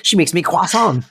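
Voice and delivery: French accent, hammed-up; mock French accent. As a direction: put on a silly French accent. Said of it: "I cannot do a French accent".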